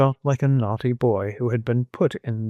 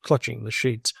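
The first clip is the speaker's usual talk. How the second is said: plain reading voice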